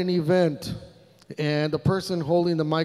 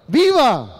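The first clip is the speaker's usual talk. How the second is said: "Viva!" yelled out high and loud; a rally cry to get the room shouting; 7 semitones higher, 8 dB louder than usual. Real, not acted. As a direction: lead the crowd with a full-voiced "Viva!". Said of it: "I want you to yell it as loud as you can".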